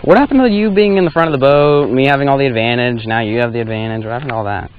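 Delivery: ordinary talk, nothing marked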